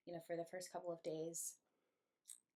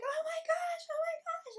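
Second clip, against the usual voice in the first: high frantic squeal of alarm; panicked shriek; 6 dB louder than usual. Retold or imitated. Telling friends about the shark bite, high panicked shriek. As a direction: shriek it like something just bit you.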